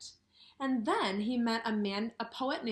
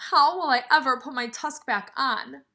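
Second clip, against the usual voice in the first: mild wail; 4 semitones higher, 9 dB louder than usual. Acted. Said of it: "Ganesha wailed".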